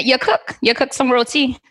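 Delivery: playful Trinidadian mom accent. Shown as a demonstration. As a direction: repeat the phrase in a put-on Trinidadian accent. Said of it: "Say it again".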